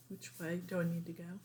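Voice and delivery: usual speaking voice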